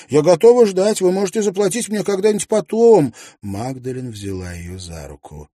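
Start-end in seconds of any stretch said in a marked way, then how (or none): 0.1-3.1 put-on much higher, louder young girl's plea; a frightened maid begging to stay; pleading maid. A narrator's reading. Луиза умоляет хозяйку. (высокий громкий голос испуганной служанки)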